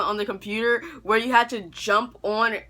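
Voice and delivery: usual speaking voice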